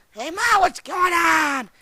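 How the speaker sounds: high, loud and slow call; drawn-out shouted hello; shouted greeting. Retold or imitated. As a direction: yell across to your mom. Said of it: "So I was like".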